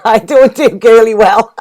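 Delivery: laughing. Real, not acted. Reader laughing at herself for getting girly, laughing. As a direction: laugh off your own soppiness. Laughs off her own bashfulness.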